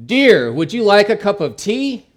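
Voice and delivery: loud, high-pitched call; insistent shouted question. Acted. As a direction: raise your voice to be heard by someone hard of hearing. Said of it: "he said in a louder voice".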